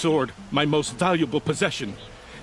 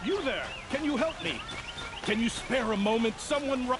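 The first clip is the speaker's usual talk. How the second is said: vagrant; 10 semitones higher, 6 dB quieter than usual. Acted. Stranger calls out for help.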